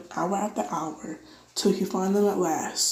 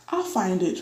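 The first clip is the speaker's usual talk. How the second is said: ordinary delivery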